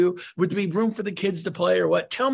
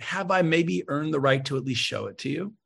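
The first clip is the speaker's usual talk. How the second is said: ordinary talk, nothing marked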